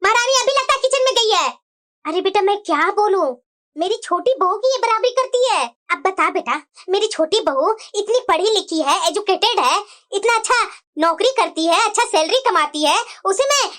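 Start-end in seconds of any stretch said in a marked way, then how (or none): 2.0-13.7 put-on much lower old grandmother voice, grumbling; a cranky grandma complaining about her granddaughter; scolding grandmother. Grandmother complaining about the cheeky granddaughter, low grumbling voice.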